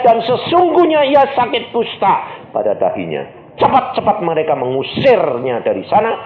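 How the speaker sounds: emphatic pulpit scripture reading; full-force preaching. Acted. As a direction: build the verse into a high, forceful cry.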